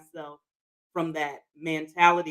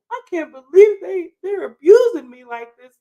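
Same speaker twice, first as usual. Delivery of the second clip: mock self-pity; 11 semitones higher, 7 dB louder than usual. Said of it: "me saying".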